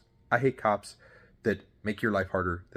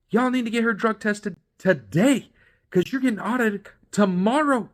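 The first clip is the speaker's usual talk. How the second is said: exasperated scolding; 12 semitones higher, 6 dB louder than usual. Real, not acted. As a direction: lay into them for the blunder. Scolding the company's mistake.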